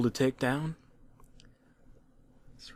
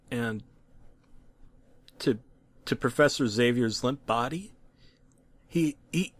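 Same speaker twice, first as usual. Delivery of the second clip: plain reading voice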